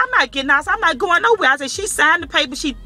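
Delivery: refusing to leave the counter, heated and high; retold defiant refusal. Retold standoff with clerk.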